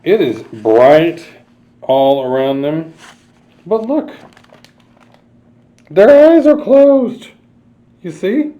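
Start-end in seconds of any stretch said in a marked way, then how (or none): 3.7-8.5 much higher, sing-song reveal; playful reveal. Acted. Storyteller pointing out the closed eyes: high playful reveal. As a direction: pop up high to show the trick.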